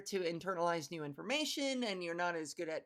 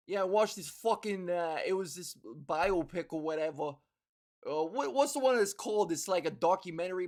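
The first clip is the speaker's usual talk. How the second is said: low-pitched New York sports fan; loud New York accent; 3 semitones lower than usual. Acted. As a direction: hold forth in a New York accent at the ballgame. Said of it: "somebody with that accent, just talking loudly".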